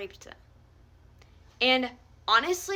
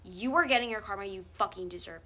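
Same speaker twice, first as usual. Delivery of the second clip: usual speaking voice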